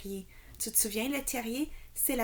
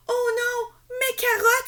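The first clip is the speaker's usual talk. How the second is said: much higher, louder cry of dismay; exclaiming; 14 semitones higher, 9 dB louder than usual. A narrator's reading. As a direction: wail over lost carrots. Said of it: "s'exclame Loulou". (cri désolé, très aigu et fort)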